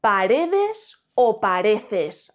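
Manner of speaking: "Paredes" y "pareces" muy lentos, sílaba a sílaba; contrapón "Paredes" y "pareces" estirándolos; comparación didáctica. Shown as a demonstration. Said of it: "¿Qué decimos?". ("Paredes" frente a "pareces", marcando cada final)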